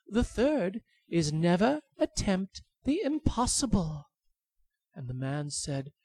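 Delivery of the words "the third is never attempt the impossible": high pleading bird voice; tiny high bird begging for its life. Acted. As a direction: squeak like a begging little bird.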